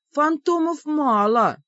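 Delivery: high, drawn-out grumble; slow, high-pitched complaint. A narrator's reading. Heroine objects to the demon's reassurance, grumbling. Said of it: "проворчала я".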